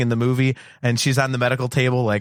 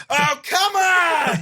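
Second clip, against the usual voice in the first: high, drawn-out exasperated shout; hammed-up groaning fan; mock yell at the screen; much slower than usual. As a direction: wail your disbelief at the screen. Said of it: "I was just yelling at the screen".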